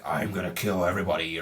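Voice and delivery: low, deep menacing villain voice; mock deep villain rumble; villain threat. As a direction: growl it like a movie monster. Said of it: "the mid-credit scene is Null, like".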